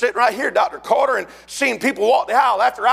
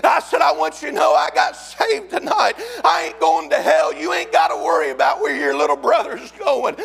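shouted Southern revival sermon; preaching at full force; 4 semitones higher than usual. Real, not acted. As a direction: preach your testimony at the top of your voice.

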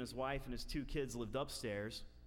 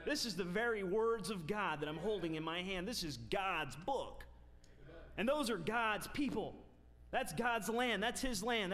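full-force preaching; 6 semitones higher than usual. Acted. Preacher driving home holiness to his congregation, full force. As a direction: proclaim it to the whole church.

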